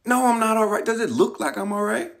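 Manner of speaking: mock indignant. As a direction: snap back at the brother. Indignant at brother's question.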